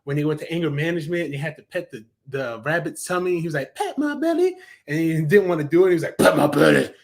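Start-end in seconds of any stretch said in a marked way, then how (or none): 3.7-4.6 high-pitched cartoon critter voice; cartoon rabbit request. Fan quoting a cartoon rabbit asking for a belly rub, high-pitched. 6.2-6.9 loud, forceful cartoon demand in a put-on voice; insistent cartoon rabbit. Fan quoting the cartoon rabbit after a refusal, loud and insistent.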